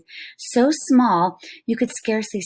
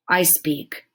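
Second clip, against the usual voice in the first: tiny Indian warrior grunting a reply. Acted. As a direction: grunt it out curtly. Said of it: "he grunted".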